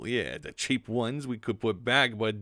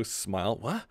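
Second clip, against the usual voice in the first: usual speaking voice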